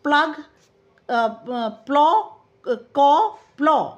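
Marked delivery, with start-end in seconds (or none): 1.7-3.9 "प्लॉ" said to rhyme with "कॉ", a bit louder; pronunciation demo. Shown as a demonstration. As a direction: push "प्लॉ" out loud so it rhymes with "कॉ". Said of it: "इसे हम इस तरह से पढ़ते हैं". ("प्लॉ" को "कॉ" की तुक में ज़ोर से बोलें)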